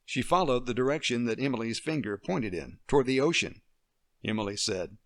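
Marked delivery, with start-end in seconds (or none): none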